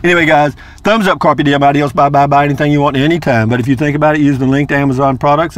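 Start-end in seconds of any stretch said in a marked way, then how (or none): none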